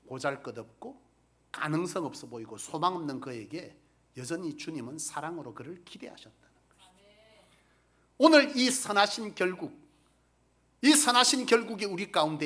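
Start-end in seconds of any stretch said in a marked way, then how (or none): 5.5-6.9 breathy hushed whisper. Real, not acted. Preacher to congregation on God's hope, whispered. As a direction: let it fall to a whisper. Tender ending of point.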